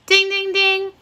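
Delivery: high, even sing-out dings; imitated bell. Teacher signalling the right answer to children, imitated bell. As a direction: chime like a quiz-show bell.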